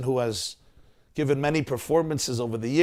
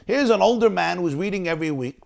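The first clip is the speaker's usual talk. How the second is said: ordinary delivery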